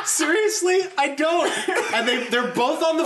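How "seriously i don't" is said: laughing quote. Retold or imitated. Mom voiced laughing on phone.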